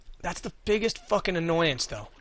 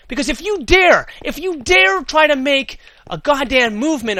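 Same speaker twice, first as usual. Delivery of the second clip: building furious rant, loud and high; escalating comedy rant; 7 semitones higher, 5 dB louder than usual. Real, not acted. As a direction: work up into a furious yelling rant. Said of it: "share how frustratingly annoyed I was".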